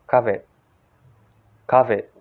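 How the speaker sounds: "covid" with open first vowel and clear v; American-style "covid", said "KAH-vid"; American pronunciation. Shown as a demonstration. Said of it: "in the American accent, they say like this".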